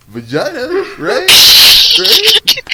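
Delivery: high, loud egging-on; hammed-up eagerness. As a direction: build to a squealed, goading question. Acting friend's eager cheering-up.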